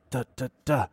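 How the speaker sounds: soft staccato "da" strum pattern; imitated strumming rhythm. Guitar teacher showing pupil a rhythm, imitated strumming. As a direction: mouth a guitar rhythm softly in short beats. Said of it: "Just follow this".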